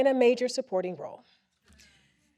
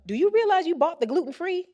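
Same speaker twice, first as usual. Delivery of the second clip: higher, teasing jab at the husband; tossed-off quip; 8 semitones higher, 3 dB louder than usual. Retold or imitated. Wife teasing husband over the gluten-free, higher tossed-off quip. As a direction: toss it off lightly and higher, like a quick dig. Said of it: "required a certain pitch and speed".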